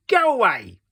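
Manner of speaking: high, loud go away; rude dismissal. Acted. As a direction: shoo him off rudely. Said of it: "He slammed his door".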